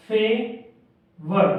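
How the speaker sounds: Favour stretched very slow; slow teaching demo of favour; drawn-out word. Shown as a demonstration. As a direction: draw out favour slowly for the class. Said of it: "फे और वर".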